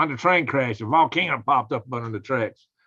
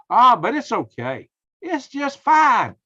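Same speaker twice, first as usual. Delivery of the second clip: drawn out and high, dripping sarcasm; mock reassurance, slow and lifted; sarcastic; 8 semitones higher, 5 dB louder, slower than usual.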